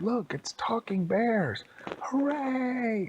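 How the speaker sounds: high, excited cheer; gleeful high-pitched townsfolk; cheering crowd. Acted. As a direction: exclaim it, ending in a long hooray.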